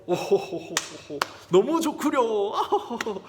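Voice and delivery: drawn-out ho-ho laughter around the words; hammed-up royal chuckle; delighted king. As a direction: act a jolly king, chortling with pleasure. King delighted at banquet invitation.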